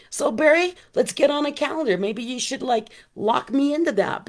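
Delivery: pulling Barry in, eager and upbeat; excited call-out. Real, not acted. Host calling out to Barry on the live, excited.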